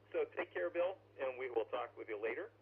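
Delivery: ordinary talk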